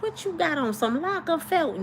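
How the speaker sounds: flirting with the handsome repairman; flirty question. Retold or imitated. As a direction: sniff him out with a playful lilt. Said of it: "You like".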